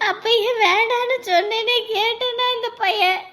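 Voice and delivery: high-pitched sobbing lament; distraught, weeping complaint; tearful wailing. Acted. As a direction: cry to your spouse in despair. Crying to spouse over disgrace.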